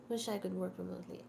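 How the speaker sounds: ordinary talk, nothing marked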